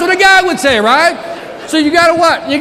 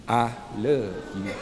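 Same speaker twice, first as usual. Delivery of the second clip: soft, slow and tender; 14 semitones lower, 11 dB quieter, slower than usual. Acted. Speaker telling the audience why he came, tender. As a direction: let it land gently. Punchline after quiet build.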